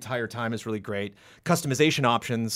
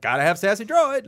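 mocking gripe; 9 semitones higher, 4 dB louder than usual.

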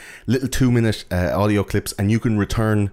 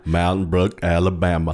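put-on Alabama accent. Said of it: "Will I do the whole rest of the show like this".

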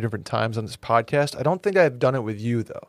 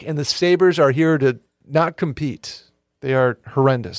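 usual speaking voice

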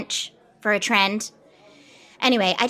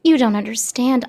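usual speaking voice

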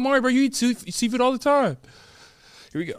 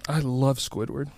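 gagging; 8 semitones lower, 5 dB quieter than usual. Real, not acted. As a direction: choke it down while gagging. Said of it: "I'm gagging a little bit".

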